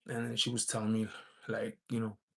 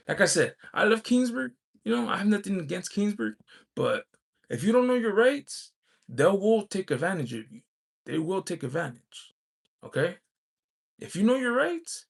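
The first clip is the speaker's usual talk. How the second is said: pitched up and louder, insisting; heated, raised voice; 7 semitones higher, 5 dB louder than usual. Real, not acted. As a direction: warn them hard, voice climbing. Heated warning about knowing rights.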